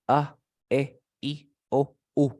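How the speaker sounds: "A, E, I, O, U" as short separate voiced sounds; vowel sounds. Shown as a demonstration. Teacher modelling the vowels for a student, each sounded separately. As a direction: sound out "A, E, I, O, U" one by one, evenly. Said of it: "El sonido de las vocales, mira".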